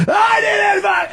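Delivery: high, drawn-out mock scream; snapping in rage. Retelling the scene where he snaps, high garbled shout. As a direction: imitate a man suddenly snapping mid-conversation. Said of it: "it just snaps".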